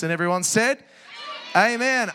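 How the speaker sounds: loud rising call for the amen. Real, not acted. Pastor calling the church to answer, loud call. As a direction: pull a loud amen from the room.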